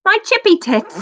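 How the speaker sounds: high squeaky British exclamation; British accent. Acted. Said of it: "the best British accent".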